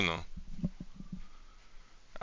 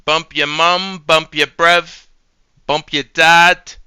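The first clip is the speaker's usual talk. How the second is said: loud, slow, punched British taunt; higher put-on British voice, drawn-out words; mock British; 5 semitones higher, 7 dB louder, slower than usual. Taunting someone's whole family, loud mock British accent.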